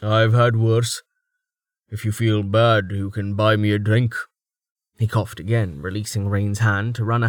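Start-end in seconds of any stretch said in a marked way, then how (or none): 0.0-4.1 gruff warrior voice. Acted. Thrast, gruff fighter voiced.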